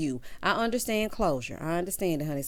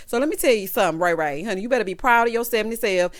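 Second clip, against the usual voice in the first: ordinary talk, nothing marked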